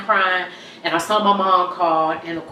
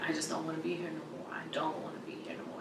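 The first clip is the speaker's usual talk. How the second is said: very soft, rapid, nearly voiceless plea; hushed despair; 18 dB quieter, much faster than usual. Retold or imitated. Telling sister Michelle she wants out, hushed despair. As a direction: let the words slip out barely above a whisper.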